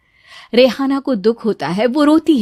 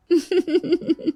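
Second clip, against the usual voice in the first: soft, higher flirty laugh; high giggle; 7 semitones higher, 5 dB quieter than usual.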